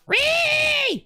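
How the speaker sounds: very high, piercing "reee" squeal; "ree" screech. Retold or imitated. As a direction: act out a sudden shrieking "ree" at the phone. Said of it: "and I'll go".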